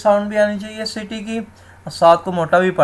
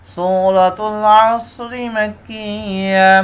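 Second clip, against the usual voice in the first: slow, melodic tajweed recitation; Quran recitation; 3 semitones higher, much slower than usual. Acted. Teacher recites the whole surah for students, melodic chant. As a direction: chant the surah slowly and evenly.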